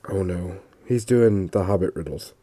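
low, quiet sinking realization; retold dread, softly deadpanned; muted dismay. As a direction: groan it inwardly, low and under your breath. Dismayed at copied riddles.

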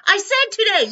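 hammered-up argument voice; loud insistence. Retold or imitated. Retelling a quarrel with co-host.

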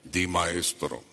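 sanctimonious churchy voice; mock religious voice. Shown as a demonstration. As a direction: parody a self-righteous Pharisee. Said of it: "porque era muy religioso".

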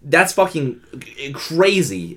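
ordinary talk

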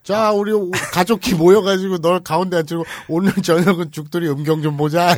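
rally everyone with mock enthusiasm; mock family gathering call. Host mocking a relative addressing the gathered family, loud hammed-up call. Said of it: "이래요?".

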